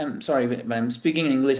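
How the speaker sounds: ordinary talk, nothing marked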